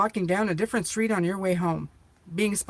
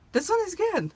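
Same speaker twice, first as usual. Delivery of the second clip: much higher, squealing with joy; squeaky delight; 12 semitones higher than usual. Real, not acted. Repeat even higher.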